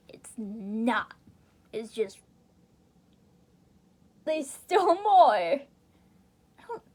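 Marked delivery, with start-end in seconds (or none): 4.2-5.3 slow, louder emphatic point; insistent. Real, not acted. Reader correcting themselves about the game, insistent. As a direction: drag it out to insist.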